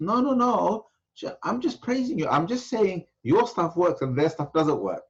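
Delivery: high-pitched imitation of an apologetic student; placating protest. Man defends his flattery to the upset sheikh, high placating protest. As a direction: protest innocence in a mock high voice.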